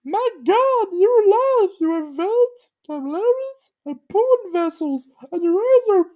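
very high awed cartoon voice; squeaky amazement; Pokey's high voice. A narrator's reading. Pokey marvels at Gumby, very high voice. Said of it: "Pokey said".

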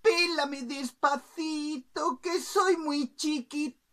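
high, loud, slow singsong; pleading little bird. Acted. Bird pipes up from the pot, high slow plea. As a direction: stretch the plea high until cut off. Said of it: "asomó la cabeza y dijo".

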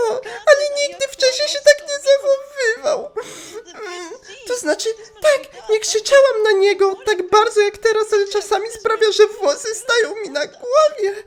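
squeaky fake whine as the upset girl; shrill upset girl voice. Osana complaining about Taro, high and whiny. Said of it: "jaki głos miała Osana".

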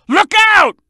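high, sudden warning shout; quick, high cry of alarm; urgent warning. Acted. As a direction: shout to alert a partner in danger.

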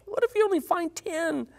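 Abraham's last plea, high and desperate; humble desperate plea. Acted. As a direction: beg the Lord for the last ten, pitch soaring. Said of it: "you could just sense the humility from him here".

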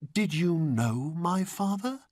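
put-on high boyish question; Aladdin. A narrator's reading. Boy questions old man, high and curious. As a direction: ask curiously, voice pitched well up.

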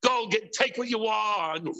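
normal talking voice

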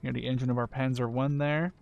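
ordinary delivery

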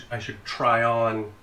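ordinary talk

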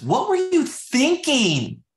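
high, loud and slow, leaning hard on "were"; exasperated what were you thinking. Shown as a demonstration. Manager scolding an agent after a bad call, exasperated and loud. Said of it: "See the difference?".

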